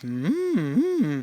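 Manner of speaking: humming that slides in pitch; hum, moving the pitch up and down; pitch-varying hum. Shown as a demonstration. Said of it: "varying your pitch".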